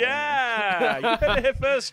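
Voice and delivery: loud, high gleeful crowing; delighted cohost pouncing on a compliment, loud and high; excited. Real, not acted. Gleeful gotcha to listeners.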